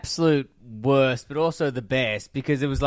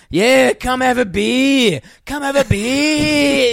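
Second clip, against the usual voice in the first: put-on slow, high, loud drunken taunt; 8 semitones higher, 5 dB louder, slower than usual. Drunk mate daring him over for a beer, slow loud taunt. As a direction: drag out the dare mockingly. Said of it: "He was, like, taunting me".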